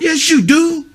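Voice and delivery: real scolding, voice jumping up; firm contradiction. Real, not acted. Firm retort to a child's denial.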